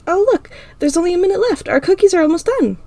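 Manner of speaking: put-on high, cheery girl voice; bright friend. A narrator's reading. Ira distracting them both, high and cheery. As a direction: chirp it to change the subject.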